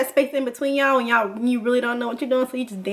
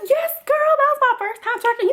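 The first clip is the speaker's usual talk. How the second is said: very high, giddy acted reply; high-pitched mock gushing; gushing brag; 12 semitones higher than usual. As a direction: squeal it proudly like an excited teen. Acts out her proud answer.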